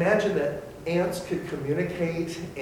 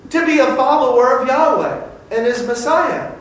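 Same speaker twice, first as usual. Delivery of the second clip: full-force preaching; 12 semitones higher, 13 dB louder than usual. Acted. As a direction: proclaim it to the whole room.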